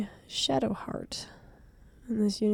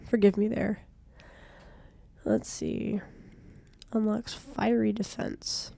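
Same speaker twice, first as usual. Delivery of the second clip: ordinary delivery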